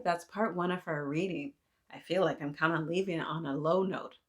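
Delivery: usual speaking voice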